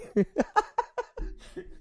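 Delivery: high, wheezy giggle; laughter. Real, not acted. Laughs at the listener's claim.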